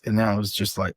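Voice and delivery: ordinary delivery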